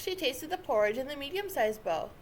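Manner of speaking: ordinary delivery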